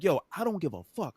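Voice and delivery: indignant, cutting off the excuse; retold snapping. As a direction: re-enact snapping back at her. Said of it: "I'm so I'm snapping".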